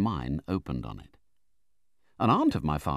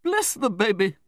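high, loud outburst; exasperated exclamation; 6 dB louder than usual. A narrator's reading. Aunt reacts to the naive widow, exasperated. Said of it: "exclaimed Miss Betsy".

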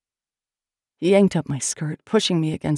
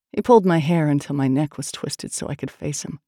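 plain reading voice

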